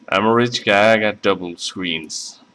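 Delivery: ordinary talk, nothing marked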